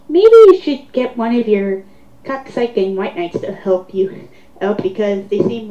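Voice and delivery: usual speaking voice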